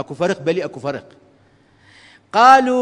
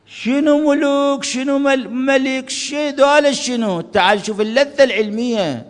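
mock ecstatic scholar; 7 semitones higher, 3 dB louder, slower than usual. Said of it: "يصيح بصوت عالي".

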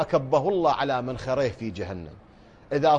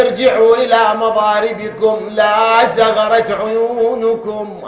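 loud, raised preaching voice; higher, louder sermon cadence; preaching at full force; 5 semitones higher, 8 dB louder, slower than usual. Acted. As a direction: drive it home to the crowd.

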